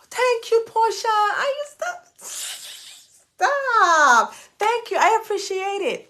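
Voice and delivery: overwhelmed squeal. Real, not acted. Host thanking viewer Portia, high squealing thanks. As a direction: gush out the thanks, teary. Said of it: "I feel like crying".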